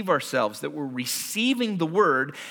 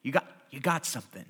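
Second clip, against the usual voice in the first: discreet tip-off; 5 semitones lower, 7 dB quieter, much faster than usual. Retold or imitated. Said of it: "kinda looks at you and goes".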